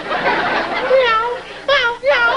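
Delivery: high, squeaky cat meowing; kitten meows. Pretend. Man with a sore throat mewing like kittens to his doctor. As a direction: mew like kittens stuck in your throat. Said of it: "a litter of kittens in there".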